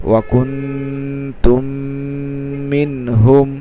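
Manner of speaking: ওয়াকুনতুম মিনহুম drawn out very slowly with the nasals held; ওয়াকুনতুম মিনহুম chanted in slow tajweed style; Quranic recitation with ghunnah. Shown as a demonstration. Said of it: "তাহলে কি হলো?".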